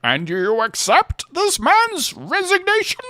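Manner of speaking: high, slow rising Bane line; Bane impression. Comparing Bane to Walken, slow rising Bane. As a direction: lilt it up and down Walken-style. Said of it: "in the up and down".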